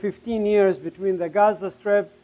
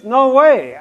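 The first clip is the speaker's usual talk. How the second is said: loud, raised retold protest; defiant refusal; 4 semitones higher, 9 dB louder than usual. Refusing to make peace with enemies, loud defiance. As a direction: punch out the refusal. Acting out his earlier refusal.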